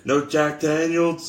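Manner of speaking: punchy wrestler impression; Stone Cold Steve Austin. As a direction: call it out for the crowd's response. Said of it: "this is your boy, Stone Cold Steve Austin".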